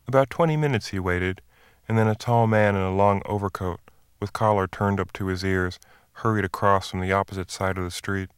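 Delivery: ordinary delivery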